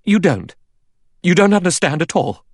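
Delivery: loud, high-pitched denial; agitated protest. A narrator's reading. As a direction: protest in alarm, voice leaping up. Vera protests to the General.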